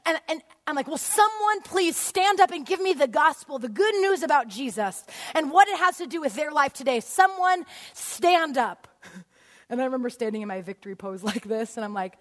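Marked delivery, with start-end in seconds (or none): 0.9-8.9 yelling. Acted. Teacher begging a student to stand up, yelling. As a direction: plead at full voice to the room. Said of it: "I'm yelling".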